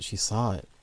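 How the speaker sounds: plain reading voice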